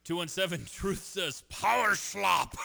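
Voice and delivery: slow, booming read of the text; loud mock announcement.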